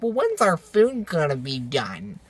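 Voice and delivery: mocking teen customers, slow and quiet; imitated teen impatience, drawled; impatient teen girls. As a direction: drag out the question like a put-out teenager. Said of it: "That's exactly how they sounded".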